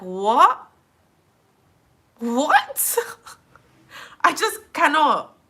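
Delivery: high, loud, rising whats into slow despair; exasperated disbelief. Real, not acted. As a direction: squawk in disbelief, then give up dramatically. Baffled by restaurant's excuse.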